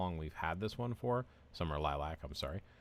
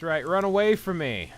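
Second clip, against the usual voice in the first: taunting shout; 9 semitones higher, 11 dB louder than usual. Acted. Taunting enemies in game.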